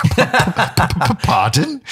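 high laughing stammered pardon; stammering surprise. Retold or imitated. Hearing he can't drive, high laughing stammered surprise. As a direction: stutter in disbelief. Stunned by doctor's news.

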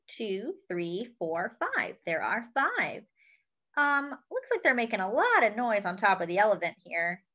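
plain reading voice

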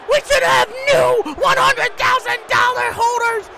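high-pitched frantic protest; screamed outrage. Real, not acted. Commentator outraged at a missed pinfall, screaming high. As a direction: scream it in disbelief.

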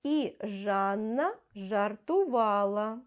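slow, even reading aloud; slow model reading. Shown as a demonstration. Teacher reads the sentence for first graders, slow and clear. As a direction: spell it out slowly for beginners. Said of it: "Наступне речення".

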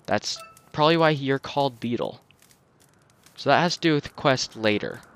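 ordinary talk, nothing marked

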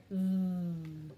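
v as a long low voiced "th", as in mother; cluster sound. Shown as a demonstration. Teacher drilling consonant clusters, v as voiced "th". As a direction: buzz v as "thhh".

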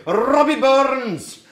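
Robbie Burns with a rolled R, high and loud; Scottish accent. Shown as a demonstration. Teacher rolling the R for the class. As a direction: roll the R in Robbie like a Scot. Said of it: "you have to roll the R's".

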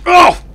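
very high, loud yelp; piercing cry of surprise; startled shriek. Real, not acted. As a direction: shriek in sudden alarm. Caught off guard in game.

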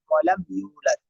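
ordinary talk, nothing marked

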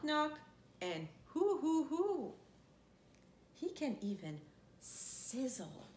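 ordinary delivery

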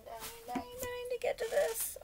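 high breathless whisper, beside herself; gasping it out, almost squeaking with glee; excited squeal. Real, not acted. Squealing over new brushes.

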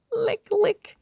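high, light lick lick; playful licking sound. A narrator's reading. Narrator voicing a pug licking a sleeping girl, high and playful.

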